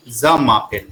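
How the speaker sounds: ज मापेल said ending on the l, last letter silent; teacher correcting a student's French; French pronunciation demo. Shown as a demonstration. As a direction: model ज मापेल with the l clearly sounded. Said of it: "एल तक ही pronounce करेंगे".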